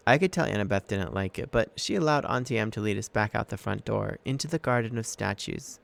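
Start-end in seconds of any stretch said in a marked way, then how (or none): none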